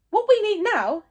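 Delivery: loud, forced confidence; trying to sound in charge. Acted. Chief Stoic to his tribe, faking control loudly. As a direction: bluff authority over rising panic. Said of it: "trying to sound as if he was on top of the situation".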